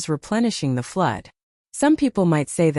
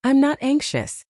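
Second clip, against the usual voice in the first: muffled and higher; 8 semitones higher than usual. A narrator's reading. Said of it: "said in a muffled voice".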